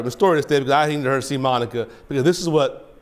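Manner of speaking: ordinary delivery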